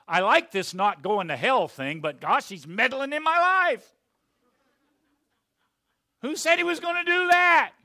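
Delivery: high, loud, complaining voice; mock the grumbling believer; indignant whine; whiny objector.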